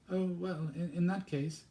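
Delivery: put-on high, timid girl's question; young girl's voice. Girl asks stern stranger at the door, high and polite.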